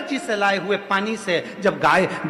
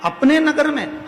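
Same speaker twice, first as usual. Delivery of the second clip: high, louder incredulous repeat; shocked question; 9 semitones higher, 4 dB louder than usual. Acted. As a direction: echo the news in acted astonishment. King repeats his shocked question.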